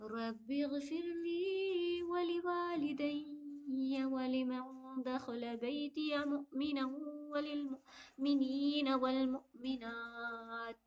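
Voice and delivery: slow, soft, melodic tilawat; Quranic recitation. Shown as a demonstration. Teacher reciting the full passage for students, slow and melodic. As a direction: chant the verse slowly with care. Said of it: "अब यहाँ तक हम तिलावत करते हैं".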